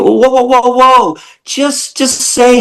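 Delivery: high, exasperated cry to halt them; exasperated whoa. Real, not acted. Host cutting off excuse-makers, high exasperated whoas. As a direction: throw up your hands and stop them. Stopping excuses mid-rant.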